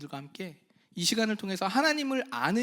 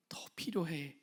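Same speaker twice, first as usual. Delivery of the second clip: whisper; 3 semitones lower, 10 dB quieter than usual. Acted. Whispered aside in sermon.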